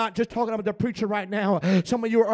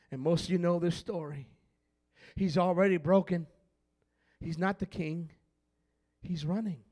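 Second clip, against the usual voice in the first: ordinary talk, nothing marked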